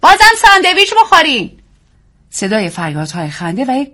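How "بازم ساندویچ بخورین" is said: very high, loud party shriek; woman at a rowdy party shrieking to her guests. A narrator's reading. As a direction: shriek it over the party noise. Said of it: "زنی جیغ کشید".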